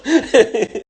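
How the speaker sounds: loud high-pitched laugh; cheeky loud laugh. Real, not acted. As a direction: burst out laughing. Flirting with blonde trainer.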